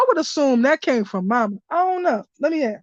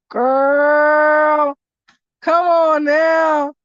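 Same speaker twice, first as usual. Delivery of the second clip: excited shout; 3 semitones higher, 5 dB louder, much slower than usual. Real, not acted. Reader calls out to her friend about the message, in a slow loud shout. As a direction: stretch it out in disbelief.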